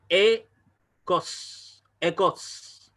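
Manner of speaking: "ecos" drawn out, the first e barely there; slow demonstration. Shown as a demonstration. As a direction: stretch "ecos" slowly, swallowing the opening e. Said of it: "Tenemos aquí en esta palabra".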